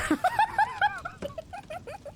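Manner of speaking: high-pitched giggle; high laugh. Real, not acted. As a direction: burst out in a high cackle. Laughing at own trick.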